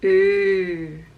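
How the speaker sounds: oo held long with round lips; drawn-out oo. Shown as a demonstration. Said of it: "a longer sound of oo".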